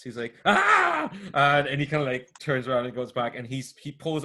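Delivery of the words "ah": high, loud startled shriek; startled scream. Acted. Startled youth tapped on the shoulder, screaming in shock. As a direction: yelp in sudden fright. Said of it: "no, he screams".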